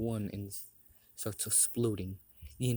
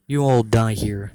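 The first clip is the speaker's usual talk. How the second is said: much louder, menacing declaration; loud threat; 14 dB louder than usual. Acted. Leader tells trapped Zod he will die, loud threat. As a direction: deliver the death sentence at full volume.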